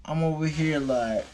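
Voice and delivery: usual speaking voice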